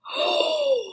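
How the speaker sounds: sharp high gasp of alarm. Acted. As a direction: gasp at the sight of the hat. Belle finds father's hat.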